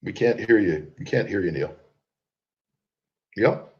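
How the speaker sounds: ordinary talk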